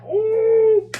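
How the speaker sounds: loud, high shocked ooh; loud high-pitched ooh at the gun. Retold or imitated. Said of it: "I'm like".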